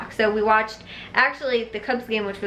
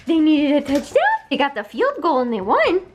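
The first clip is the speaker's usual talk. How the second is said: teasing dog talk; 5 semitones higher than usual. Shown as a demonstration. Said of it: "Like this".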